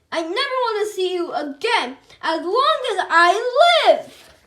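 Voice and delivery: loud tearful yell at the hamster; yelling through tears. A narrator's reading. Melvin yelling at the hamster as he runs off sobbing, loud tearful cry. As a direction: wail it out, voice rising to a yell. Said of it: "ran out the classroom sobbing".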